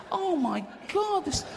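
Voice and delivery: high, slow, drawn-out dismay; acted high-pitched disgust; mock horror. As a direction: wail it in hammed-up horror. Acting horror in filthy cubicle.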